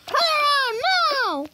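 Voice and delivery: high, loud angry yell; furious parent yell. Acted. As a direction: scream the order. Parent yelling.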